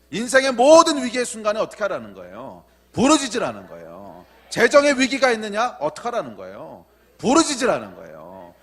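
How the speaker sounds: high, loud question and falling, hammered answer; full-force preaching. Real, not acted. Preacher asks congregation what to do in each crisis, fervent and loud. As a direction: work the congregation up in call and answer.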